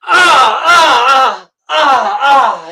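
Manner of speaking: ecstatic shouting. Real, not acted. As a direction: cry out in delight. Host cheers the love scene.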